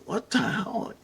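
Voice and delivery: high-pitched, incredulous reaction; baffled surprise. Retold or imitated. Man spotting geckos on his own shirt, baffled surprise. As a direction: replay the bewilderment, pitch jumping up.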